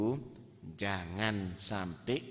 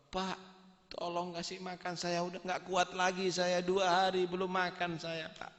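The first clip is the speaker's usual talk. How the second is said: begging beggar; 7 semitones higher than usual. Acted.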